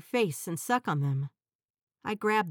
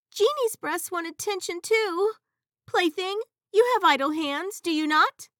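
high, louder pouty genie voice; pouting genie; 11 semitones higher, 6 dB louder than usual. Acted. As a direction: sulk for attention, high and loud. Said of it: "I pout and say".

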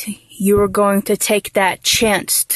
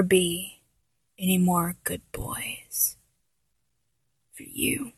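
whispered; 3 semitones lower, 11 dB quieter than usual. Acted. Denies him praise.

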